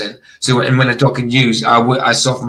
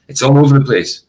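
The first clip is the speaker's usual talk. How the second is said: ordinary talk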